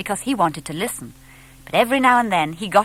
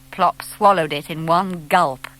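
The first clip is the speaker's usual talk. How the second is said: ordinary delivery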